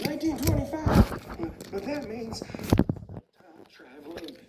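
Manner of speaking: very high freaked-out cartoon truck; Mater. Truck realizes he time traveled, high alarmed voice. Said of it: "Mater freaks out".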